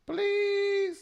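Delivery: high mock plea on Please; a hammed-up begging Please. Host pleading with the singer, high mock begging.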